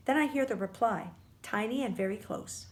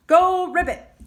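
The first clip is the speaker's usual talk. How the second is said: ordinary delivery, nothing marked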